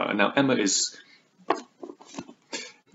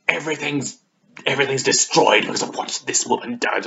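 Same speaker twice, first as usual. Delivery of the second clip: mock melodrama; 5 semitones higher, 3 dB louder than usual. Acted overwrought quote from novel.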